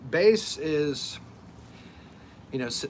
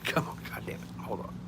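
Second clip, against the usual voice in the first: hushed muttering at the dog; under-breath scolding the dog; hushing a pet; 6 dB quieter than usual. Real, not acted.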